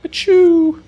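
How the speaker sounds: loud, high put-on sneeze; a big hammy achoo; fake sneeze. Sudden fake sneeze among gameplay chat.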